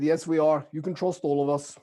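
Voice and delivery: put-on Norwegian accent. Traveler vouching for all Norwegians, Norwegian accent. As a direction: reassure them in a Norwegian accent. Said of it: "with a Norwegian accent too".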